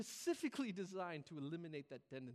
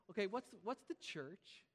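ordinary talk, nothing marked

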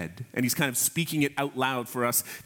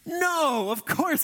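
emphatic no; 13 semitones higher, slower than usual. Real, not acted. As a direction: shoot the idea down with a high yelp. Preacher rejecting an absurd thought.

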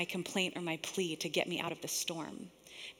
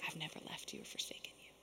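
very soft, lower near-whisper; hushed reassurance; 4 semitones lower, 17 dB quieter, faster than usual. Acted. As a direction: murmur it low, as God reassuring someone. God reassuring in quiet voice.

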